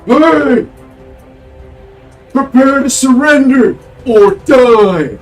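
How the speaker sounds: huge giant bellowing a threat; booming male voice. Acted. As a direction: roar it down from the enemy ship, slow. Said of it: "you hear a booming voice".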